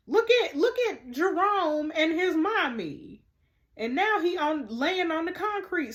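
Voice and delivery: high, loud, slowed-down aww; cooing aww. Real, not acted. Cooing over a childhood photo.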